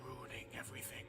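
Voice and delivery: hushed, voiceless character voice; whispering captive character. Acted. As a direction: whisper it like a weakened prisoner. Game master voices weakened character.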